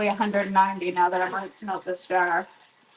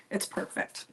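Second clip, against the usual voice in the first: hushed, near-whispered "It's perfect."; soft murmur; 11 dB quieter than usual. Real, not acted. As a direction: murmur "It's perfect." under your breath. Quiet satisfied check of water.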